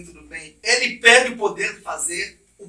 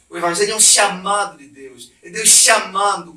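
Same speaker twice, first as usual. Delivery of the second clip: ordinary talk, nothing marked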